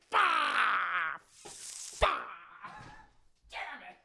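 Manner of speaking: strained, muffled outbursts with long gaps; mock-mad cursing. Husband mad at hearing she's pregnant, hammed-up anger. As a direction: curse in hammed-up frustration. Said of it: "He is so mad when he hears that".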